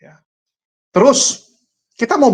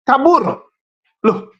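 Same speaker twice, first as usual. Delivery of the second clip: ordinary delivery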